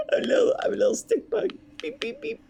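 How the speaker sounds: cartoon insect beeps; tiny bug voice. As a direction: squeak like a little stick bug. Playing a stick bug.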